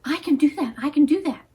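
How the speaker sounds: put-on boy thrilled to run home to Mom; excited boy. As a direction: burst out with a child's excitement. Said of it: "Johnny Red Cloud was so excited".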